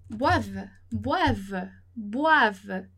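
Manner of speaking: boivent said as bwav, final ENT silent; silent ending. Shown as a demonstration. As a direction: repeat boivent slowly, ending on the v sound, no ENT. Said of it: "não vai pronunciar o E N T ali no final".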